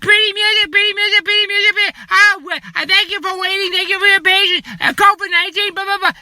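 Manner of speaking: high sing-song mock of the hold recording; mocking hold message. Mocking the phone company's hold recording, high sing-song. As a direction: parody the phone company's canned voice. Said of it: "mocking the hold message".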